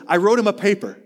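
a student proudly telling his pastor about his paper; proud kid. Retold or imitated. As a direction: announce it proudly. Luke's proud answer.

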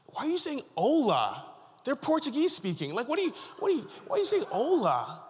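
high-pitched, baffled questioning; retold incredulity. Preacher challenging students' Portuguese mix-up in Brazil, incredulous. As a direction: question them in high, mock disbelief.

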